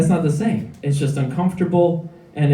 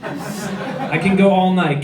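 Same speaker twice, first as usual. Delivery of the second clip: normal talking voice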